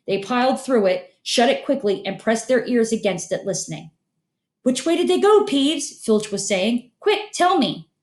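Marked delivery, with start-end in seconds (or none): 4.6-7.9 high urgent demanding voice; gruff caretaker. Acted. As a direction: demand it, out of patience. Filch hunting students, urgent.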